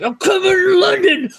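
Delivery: hammed-up roaring movie line; yelled Avi quote. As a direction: bellow it like a furious film gangster. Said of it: "And Avi's like".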